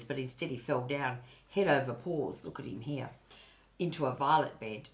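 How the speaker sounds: ordinary delivery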